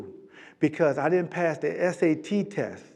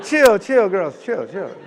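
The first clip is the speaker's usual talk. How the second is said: calming them down; 3 semitones higher, 9 dB louder, slower than usual. Retold or imitated. Calming the girls down.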